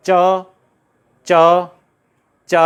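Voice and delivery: each च drawn out and set apart; palatal cha drill. Shown as a demonstration. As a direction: chant च slowly for students to copy. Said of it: "बोलिए". (च का खींचकर तालव्य अभ्यास)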